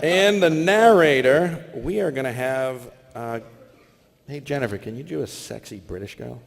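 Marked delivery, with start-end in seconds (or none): none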